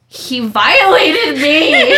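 loud, high, drawn-out mock wail; hammed-up outrage, slow and laughing. Retold complaint to coworker, hammed up.

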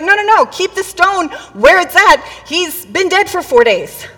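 loud, high, urgent objection; hammed-up panic to stop him; alarmed protest. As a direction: imitate frantic refusal. Acting Martha's alarmed protest.

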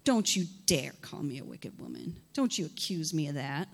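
low, soft sass; sassy. Acted. Hannah talks back to her master with low sass. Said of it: "with such sass as I am right now".

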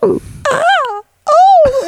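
very high, mock "ooh, ah" moans; hammed-up high oohs; imitated squeals. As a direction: squeal as if playfully smacked. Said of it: "we're both like".

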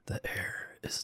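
a breathy stage whisper about the dead air. Pretend. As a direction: whisper it like a spooky secret. Mock spooky dead air remark.

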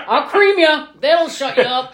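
loud, high fist-shaking bluster; mock threat. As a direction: bark it as a hammed-up bully. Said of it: "he really was shaking his fist".

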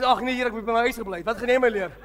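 loud, fast Afrikaans scoff breaking into a laugh; spat-out Afrikaans jeer; mocking farmer. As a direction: toss it off with a scornful laugh.

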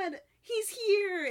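high, slow squeal of excitement; excited gasp. Retold or imitated. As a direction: gasp then squeal it out, thrilled. Excited retelling of character.

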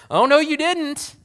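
high, loud mock indignation; hammed-up offended retort; angry, defensive. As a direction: act out an affronted comeback. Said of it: "get angry with them or defensive".

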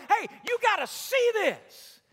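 high, loud excited call. Retold or imitated. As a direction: call a friend over in excitement. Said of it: "and say".

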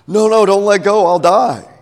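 high, loud desperate yell; panicked scream. Acted. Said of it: "she screams out".

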